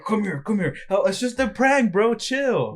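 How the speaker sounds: high, loud fake-casual prank voice; hammed-up prankster; mock prankster. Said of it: "like, play kidnap him, like".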